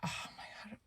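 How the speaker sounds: whispered to yourself; whispering. Real, not acted. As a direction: mutter it in a whisper. Blanking on a name.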